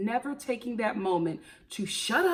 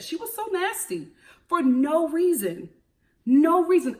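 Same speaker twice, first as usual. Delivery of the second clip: indignant; 7 semitones higher, 5 dB louder than usual. Real, not acted. Indignant rant about Courtney.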